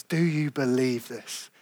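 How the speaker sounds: soft, low and slow, each word given room; solemn question. Retold or imitated. Preacher putting Jesus' question to the congregation, soft and solemn. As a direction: put the question quietly and gravely. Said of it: "hear that question from Jesus".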